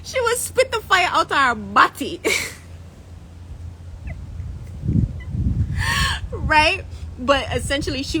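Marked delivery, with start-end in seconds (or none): 0.0-2.2 a touch of Jamaican patois, a little higher and louder; light Jamaican patois, playful. Shown as a demonstration. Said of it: "In Jamaica, we call bottom a body". 2.2-6.3 high breathless laugh; can't hold the laugh in; silent, high laugh. Real, not acted. Laughing at her own line.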